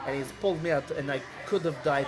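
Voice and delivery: put-on halting Eastern European accent. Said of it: "default Eastern European accent".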